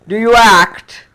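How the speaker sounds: loud, slow, drawn-out question; imitating an imposing teacher. English tutor questioning a timid student, imposing. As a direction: boom it out slowly like a grand teacher. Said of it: "spoke like that, you see".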